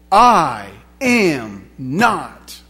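very slow, each word set apart; drawn-out, deliberate refusal; emphatic denial. Acted. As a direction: deny it one heavy word at a time. Peter's denial, acted word by word.

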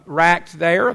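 ordinary talk

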